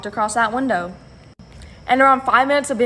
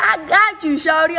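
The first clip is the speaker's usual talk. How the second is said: higher, louder, casual friend voice; friend's voice; 7 semitones higher, 3 dB louder than usual. Retold or imitated. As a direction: toss it off like a breezy friend.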